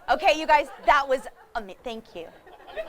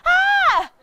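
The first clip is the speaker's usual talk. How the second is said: high loud screech back; opossum's scream; 8 dB louder than usual. Pretend. Opossum screeching back at the startled person. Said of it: "And she goes".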